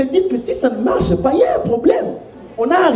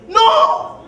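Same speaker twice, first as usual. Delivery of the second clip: a much higher "Non!" thrown out in protest; 14 semitones higher than usual. Real, not acted. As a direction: shoot the word up high in disbelief. Preacher protests calm believers.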